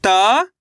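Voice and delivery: "त" alone, held and rising; letter by letter. Shown as a demonstration. Teacher starts spelling the word, isolated rising "त". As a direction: sound out "त" as one drawn-out letter. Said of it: "एक अक्षर का उच्चारण किया जाता है".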